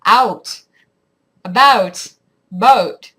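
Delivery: loud, slow American "out", "about", "boat", over the vowel; American accent. Shown as a demonstration. Canadian attempting the American side, exaggerated American accent. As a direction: punch each word with American vowels. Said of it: "your accent goes over the vowel".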